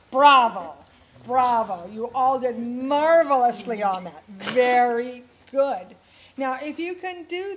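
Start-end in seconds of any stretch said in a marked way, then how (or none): 0.1-5.9 praise. Real, not acted. Praising students after the exercise.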